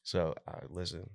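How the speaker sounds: ordinary talk, nothing marked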